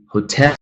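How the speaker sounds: "hotel" with the weight on -TEL; ho-TEL, stressing the last syllable; second-syllable stress. Shown as a demonstration. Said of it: "stress falling on the second".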